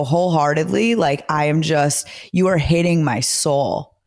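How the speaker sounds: ordinary talk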